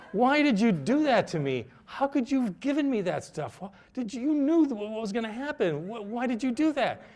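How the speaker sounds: high-pitched, rattled reproach, half-laughing; frantic accusation. Retold or imitated. To Terence after the terrifying trip, high-pitched rattled disbelief. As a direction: squeak out the accusation as if still shaken.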